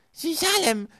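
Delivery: very high, loud squeal; shrieking toddler. A narrator's reading. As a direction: shriek it like a small child. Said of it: "kreischte Sunny". (hohes, lautes Kreischen)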